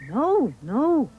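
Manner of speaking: high, loud protest; insistent cry. A narrator's reading. Jack correcting his mother about the tree, insistent cry. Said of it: "cried Jack".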